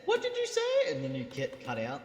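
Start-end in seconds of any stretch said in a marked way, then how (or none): 0.0-0.9 put-on very high creaky old voice; shrill elderly asking again; old person voice. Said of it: "I heard as you said that".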